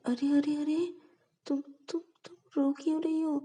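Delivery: high, anxious rush of comfort; startled and worried, trying to soothe her; alarmed concern. Acted. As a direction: friend panics seeing her cry, pitched high and anxious. Ananya sees Payal crying.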